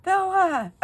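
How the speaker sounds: high, loud wail of reproach; mock outraged cry; aghast child, imitated. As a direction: wail it like an upset kid. Upset child cries out grandmother's name.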